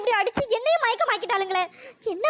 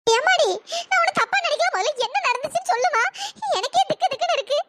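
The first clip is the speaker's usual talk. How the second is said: put-on an old grandmother torn between comfort and fear; lightly pitched-up granny voice; worried grandmother; 5 semitones higher than usual. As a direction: soothe her while your own heart races. Grandmother reassuring yet scared.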